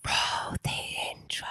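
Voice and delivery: breathy whispered cue. Shown as a demonstration. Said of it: "Let me try again".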